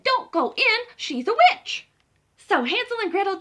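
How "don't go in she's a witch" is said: high, loud alarmed cry; urgent warning. Acted. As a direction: burst in to save them. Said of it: "little red chicken and she said".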